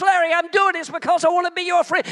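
high, loud distant echo of Jesus. Acted. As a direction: call it like a voice ringing back. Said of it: "I could hear the echo of his voice".

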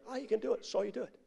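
high, soft imitated onlookers; agreeing crowd. Onlookers who watched the feat, high soft agreement. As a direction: mimic a crowd's easy assent.

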